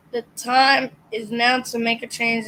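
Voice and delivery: ordinary talk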